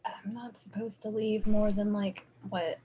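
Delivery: hushed, softly whispered aside; whispering. Acted. Stasia confiding in the doctor outside, whispering. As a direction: whisper it as if it must stay secret. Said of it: "She's whispering as if it mattered".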